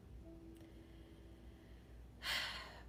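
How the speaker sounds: long, soft breathy sigh; drawn-out sigh of grief; heavy sigh. Real, not acted. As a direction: let out a long, weary breath. Worn out, holding back grief.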